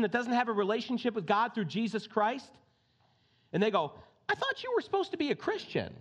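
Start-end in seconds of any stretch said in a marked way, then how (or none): 4.3-5.9 much higher, scoffing voice; high mock-scolding quote; mocking unbeliever. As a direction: sneer it like a disappointed outsider.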